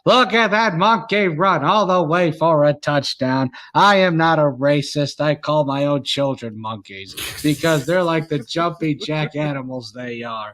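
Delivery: sportscaster calling a run in a measured, punched cadence; old-time play-by-play voice, hitting each phrase; Howard Cosell. Acted. Mock broadcaster calling the game for friends, Howard Cosell impression.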